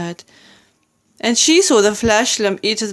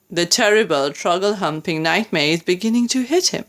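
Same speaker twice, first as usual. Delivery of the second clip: ordinary delivery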